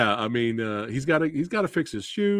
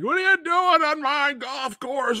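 much higher mock-indignant golfer voice; snobby golfer; 14 semitones higher than usual. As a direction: play the outraged rich golfer. Acting the angry golfer.

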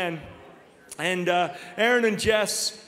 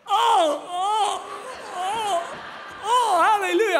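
mock pained cry; 11 semitones higher, 4 dB louder, slower than usual. Groaning up off the couch.

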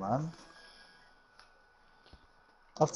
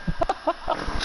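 high airy giggle; high breathy laugh. Real, not acted. Reacts to the taunt.

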